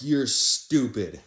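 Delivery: slow, loud, drawn-out "you're stupid"; drawn-out mock insult. To his friend, a slow drawn-out teasing insult. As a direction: stretch it out, teasing and scornful. Mocking friend after escape.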